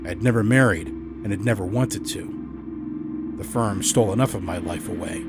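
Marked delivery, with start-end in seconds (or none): none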